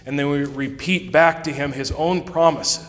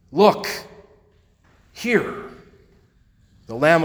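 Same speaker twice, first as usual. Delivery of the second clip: exclaimed; 3 semitones higher than usual. Real, not acted. Preacher exclaims liturgical words.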